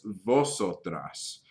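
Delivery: vosotras said very slowly, stress on so; slow Spanish word. Shown as a demonstration. Teacher slowly modeling vosotras for the class. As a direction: draw out vosotras clearly. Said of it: "or in other words".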